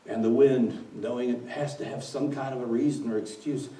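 ordinary delivery

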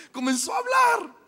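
high, loud, laughing cry; excited exclamation. Real, not acted. Preacher tells the congregation the dead man spoke, high and loud, laughing. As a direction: shout the miracle in delight.

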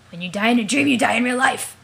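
booming ominous proclamation; dramatic shout. Acted. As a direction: belt it like a movie trailer. Dramatic ominous warning line.

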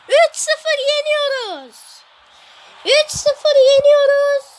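slow, loud victory call; triumphant shout. Real, not acted. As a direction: shout out the win. Celebrating win, loud, slow.

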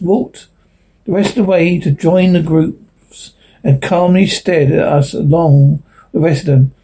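ordinary delivery

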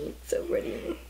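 high breathy whisper of awe; hushed gasp of admiration; awed whisper. Real, not acted. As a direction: whisper it like it's magic. Awed by highlighter.

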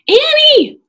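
high, loud alarmed shout; crying out. A narrator's reading. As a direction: yell her name in alarm. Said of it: "Jack cried".

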